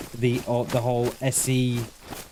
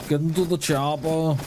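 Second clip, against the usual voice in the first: lightly put-on Austrian action-hero accent, barely there; a half-hearted Austrian muscleman growl on chopper; bad Arnie accent. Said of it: "That is probably the worst Arnie accent ever".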